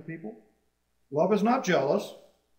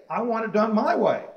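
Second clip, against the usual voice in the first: loud, higher-pitched mock insistence; demanding; 7 semitones higher, 8 dB louder than usual. Preacher voicing self-seeking church members, loudly demanding. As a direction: act out someone demanding their way. Quotes people seeking own way.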